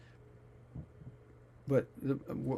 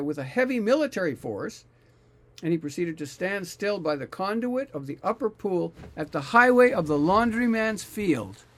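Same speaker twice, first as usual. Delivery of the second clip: scripture reading; 3 semitones higher, 4 dB louder than usual. Acted. Reads scripture passage aloud.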